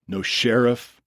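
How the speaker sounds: usual speaking voice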